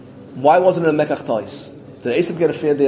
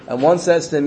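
ordinary talk, nothing marked

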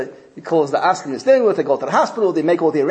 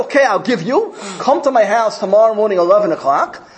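high, fast, irritated brush-off; exasperated rapid rant, raised pitch; fed-up rich man; 7 semitones higher, 3 dB louder than usual. Retold or imitated. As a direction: snap at the pestering fundraiser. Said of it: "He said".

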